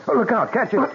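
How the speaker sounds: sudden high alarmed cry; alarmed warning. Acted. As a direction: snap out a warning as it falls. Bell falling, calling a warning.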